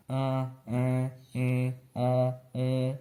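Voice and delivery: An, an, in, on, um hummed through mouth and nose; nasal vowels. Shown as a demonstration. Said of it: "Aquele som mais anasalado".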